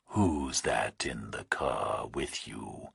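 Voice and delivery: slow, low, menacing whisper; distorted nightmare voice. A narrator's reading. Kidnapper on the phone to Burke, slow eerie whisper. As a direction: breathe it like a monster on the line. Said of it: "The nightmare voice asked".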